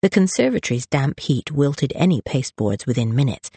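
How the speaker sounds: ordinary delivery, nothing marked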